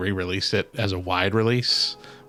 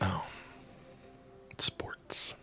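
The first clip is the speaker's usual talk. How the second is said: hushed, voiceless one-word aside; whispered aside; 3 semitones lower, 15 dB quieter than usual. Acted. Co-host reacting to football talk, whispered.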